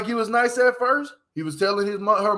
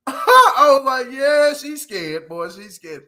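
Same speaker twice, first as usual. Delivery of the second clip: slow, laugh-filled words; cracking up mid-sentence; real laughter while retelling; 4 semitones higher, 6 dB louder, slower than usual. Real, not acted. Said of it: "I was laughing hard as hell".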